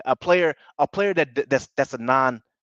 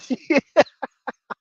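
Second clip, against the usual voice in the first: laugh; 12 semitones higher, 6 dB louder than usual. Real, not acted. Laughing at own slip.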